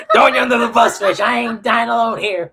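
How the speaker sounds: high, loud mock outcry through laughter; laughing protest. Joking he won't take the blame alone, laughing protest. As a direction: cry out in pretend betrayal, cracking up.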